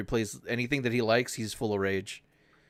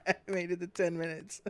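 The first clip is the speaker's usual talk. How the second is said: laughing; 7 semitones higher, 7 dB quieter than usual. Real, not acted. Repeats it laughing.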